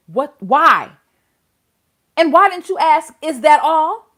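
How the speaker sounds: heated, exasperated interrogation; pissed off. Real, not acted. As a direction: rant at the judge in disbelief. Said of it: "that pissed me off so bad".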